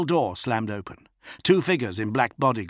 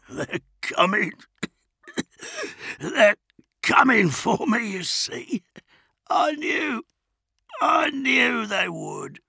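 higher, wheezing raving voice; raving prisoner; slower than usual. A narrator's reading. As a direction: rave through pain.

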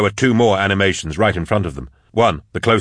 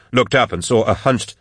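ordinary delivery, nothing marked

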